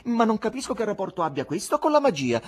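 high-pitched haughty objection; imperious wife's disdain. A narrator's reading. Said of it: "dichiarò con fare molto altero".

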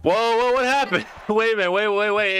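high-pitched, startled protest; startled confusion. Real, not acted. Viewer thrown by the footage jumping ahead, startled. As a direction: halt everything in surprise. Startled by sudden jump in play.

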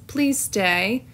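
usual speaking voice

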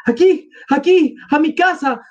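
high-pitched urgent plea; acted desperate entreaty; pleading. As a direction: beg them high to come this way. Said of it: "suplicaba Sonia".

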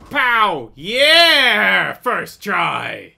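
loud, exultant cheer; triumphant shout. Real, not acted. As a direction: crow over the victory. Lands the winning hit.

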